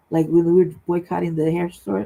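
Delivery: ordinary delivery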